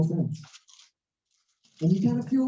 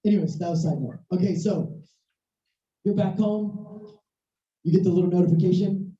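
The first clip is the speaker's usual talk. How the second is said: usual speaking voice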